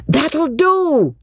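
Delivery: high, drawn-out exasperated call; shout. A narrator's reading. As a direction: call a halt, high and insistent. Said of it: "shouted Mister Beebe".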